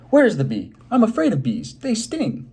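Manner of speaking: high, loud frightened yell; yelling in fright. Acted. Morris hides under the desk and yells in fear of bees. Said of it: "He yelled".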